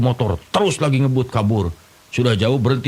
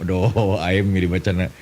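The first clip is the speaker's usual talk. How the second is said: usual speaking voice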